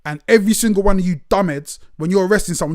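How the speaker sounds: normal talking voice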